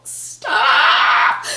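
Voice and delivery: half-crying stop; overwhelmed cry. Real, not acted. Overwhelmed by a friend's gift, crying out.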